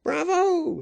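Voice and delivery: very high-pitched bravo; exclaimed cheer. A narrator's reading. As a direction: cheer in a high ringing cry. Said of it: "exclaimed another".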